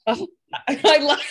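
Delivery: high, loud burst of laughter through the words; delighted laugh. Real, not acted. Laughing at her cohost's Parent Trap confession, loud delighted laughter. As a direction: crack up in sheer delight.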